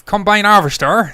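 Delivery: mock Plymouth accent; West Country accent. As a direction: sing out a hammed-up Cornish accent. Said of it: "That's fucking Plymouth, Cornwall".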